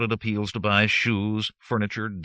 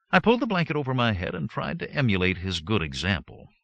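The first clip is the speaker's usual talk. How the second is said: usual speaking voice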